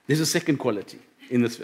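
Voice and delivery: low, soft, really moved; emotional. Real, not acted. Preacher resuming the sermon while getting emotional. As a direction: try to carry on while holding back emotion. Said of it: "I'm getting a bit emotional".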